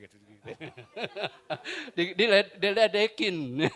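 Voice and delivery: laughing through the words. Real, not acted. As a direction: giggle as you speak. Laughing over the mockery. (sambil tertawa)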